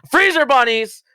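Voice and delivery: high, sudden burst of recognition; excited exclaim. Real, not acted. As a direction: blurt it out like you just remembered. Host suddenly recalls team name.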